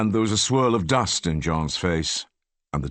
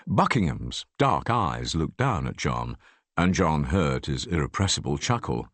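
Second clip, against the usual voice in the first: usual speaking voice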